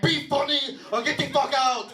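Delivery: high mock Armenian-lady yell; little lady club owner. Little Armenian lady threatening the comic, high accented yell.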